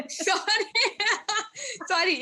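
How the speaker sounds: giggling through a sorry; laughing apology. Real, not acted. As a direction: apologise while cracking up. Laughing at own slip.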